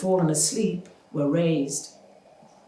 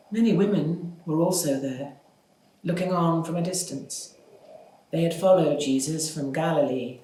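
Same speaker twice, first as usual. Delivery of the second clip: ordinary delivery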